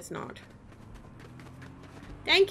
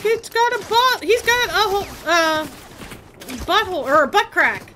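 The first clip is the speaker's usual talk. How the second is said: put-on high, loud kid voice quoting nephew; squeaky child impression; 5 semitones higher, 6 dB louder than usual. Child's words retold in high voice.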